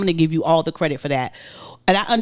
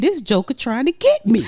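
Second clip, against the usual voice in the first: high-pitched, comically outraged thought; indignant inner voice; 7 semitones higher than usual. Retold or imitated. Husband retelling his panicked thoughts to wife, mock indignant. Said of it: "'Cause in my mind, I'm saying".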